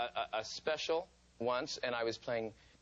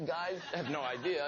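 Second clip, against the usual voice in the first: ordinary delivery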